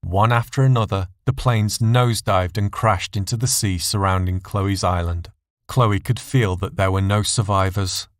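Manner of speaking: ordinary delivery, nothing marked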